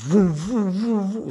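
high swooping vroom noises; repeated high "vum" engine swoops; imitated swerving car. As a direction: mimic a car fishtailing, pitched high. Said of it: "empezó a serpentear así el coche".

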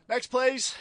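retold yell. Cashier calls the next customer, high mock yell. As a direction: imitate someone calling out, pitched right up. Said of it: "she just yells out".

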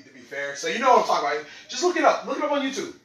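normal talking voice

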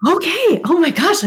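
high startled okay; surprised. Real, not acted. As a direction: caught off guard, pitch jumps.

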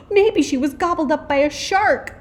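gasp, then high, loud alarm; alarmed child. A narrator's reading. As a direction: blurt out a horrified guess, high-pitched. Child imagines a dreadful fate.

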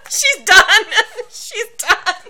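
high, loud, laughing fit; real laughter, pitch way up and loud; teasing her friend for crying, laughing hard; helpless laughter. Real, not acted. Cohost cries, reader laughs hard.

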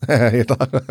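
laughing through words. Real, not acted. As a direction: crack up mid-sentence. Laughs mid-sentence.

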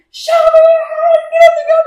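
high, loud, stretched yell; piercingly high mimicked screaming; imitated police shout. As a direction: holler commands like an armed cop. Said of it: "gritando bien duro".

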